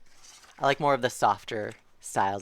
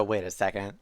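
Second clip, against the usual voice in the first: ordinary delivery, nothing marked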